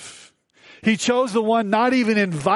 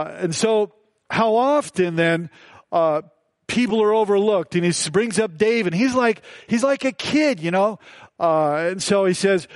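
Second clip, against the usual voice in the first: ordinary talk, nothing marked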